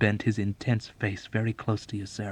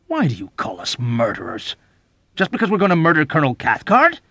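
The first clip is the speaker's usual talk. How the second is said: affronted; 7 semitones higher, 9 dB louder than usual. Acted. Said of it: "Dobbs was affronted".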